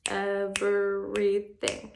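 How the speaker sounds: EVERYTHING very slow, in four even beats; syllable by syllable. Shown as a demonstration. Teacher clapping out a word's syllables for pupils, syllable by syllable. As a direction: stretch EVERYTHING out as ev-er-y-thing. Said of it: "we're gonna snap the syllables".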